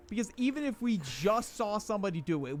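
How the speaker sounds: ordinary talk, nothing marked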